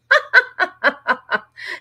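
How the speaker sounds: bursts into a high, loud laugh; real laugh. Real, not acted. Laughing at a viewer's comment, high and loud. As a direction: genuine laugh at a chat comment.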